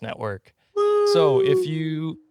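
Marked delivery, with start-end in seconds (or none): 1.1-2.2 high, loud woo; excited whoop. Real, not acted. Host cheers mid-plug for the network, high and loud. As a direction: let out a sudden cheer.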